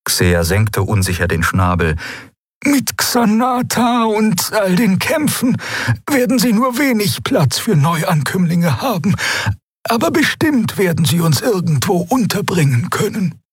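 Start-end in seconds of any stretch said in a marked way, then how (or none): 2.7-13.4 high creature voice, doubtful then hopeful; uncertain bird creature Xea. A narrator's reading. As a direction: sound unsure in a high birdlike voice. Xea's voice.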